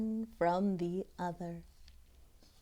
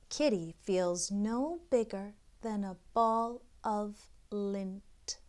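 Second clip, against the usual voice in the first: ordinary delivery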